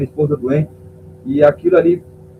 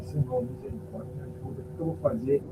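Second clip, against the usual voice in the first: near-whispered plea, much softer; whispered prayer; 2 semitones lower, 14 dB quieter than usual. Retold or imitated. Pastor praying silently to God in church, almost whispered. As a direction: pray under your breath, pleading.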